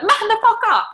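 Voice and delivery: high, loud exasperated yell; hammed-up high shout; mock self-scolding yell. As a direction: yell it at yourself as a comic telling-off. Acts out scolding her own face.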